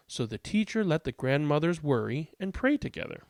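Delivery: expressive read-aloud with lilting emphasis; picture-book narration. A narrator's reading. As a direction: read aloud to children, lively and measured.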